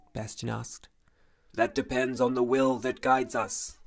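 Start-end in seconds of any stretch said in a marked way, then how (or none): 1.5-3.8 a touch of a cold, mechanical armored giant; lightly voiced iron guard answering; metallic giant voice. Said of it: "said the metallic voice".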